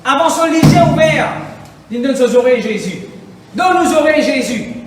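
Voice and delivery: loud, high fervent sermon cry; shouting the call to open ears to God; full-force preaching. Acted. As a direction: preach it out to the whole church. Preacher exhorts congregation.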